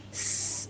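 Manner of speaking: soft voiceless hiss like a pressure cooker; cooker whistle hiss. Shown as a demonstration. Teacher imitates a pressure cooker's whistle with a hiss. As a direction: whistle out a thin sss. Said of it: "پہلے سیٹی کو نکالیں گے".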